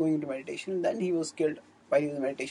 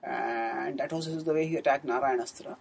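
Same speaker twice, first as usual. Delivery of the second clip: usual speaking voice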